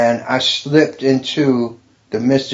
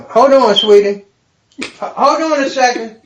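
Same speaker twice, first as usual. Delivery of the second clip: raised, higher voice to someone nearby; calling aside; 11 semitones higher, 5 dB louder than usual. Real, not acted. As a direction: break off and call out to sweetie.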